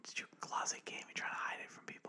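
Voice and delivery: teasing whisper. Pretend. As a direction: whisper a playful tease. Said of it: "Kinda sexy".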